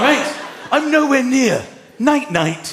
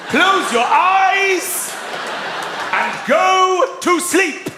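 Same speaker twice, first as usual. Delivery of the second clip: angry parent; 8 semitones higher, much slower than usual. Acted. Dad acts out ordering his children to bed, angry. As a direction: bark at the kids to sleep. Acted furious bedtime parent.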